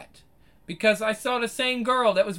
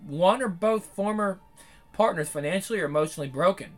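quick, low, even read of a written essay; reading someone's article aloud to viewers; 4 semitones lower, 5 dB quieter, faster than usual. Acted. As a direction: read the piece through at a steady clip.

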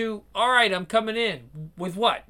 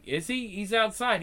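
usual speaking voice

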